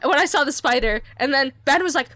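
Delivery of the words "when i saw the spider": ordinary talk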